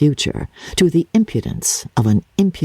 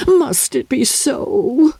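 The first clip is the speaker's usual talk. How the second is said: tearful; 11 semitones higher, much slower than usual. Acted. Mother grieving at parting.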